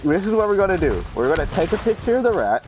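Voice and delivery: high, emphatic plan; mock heated self. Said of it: "I'm like".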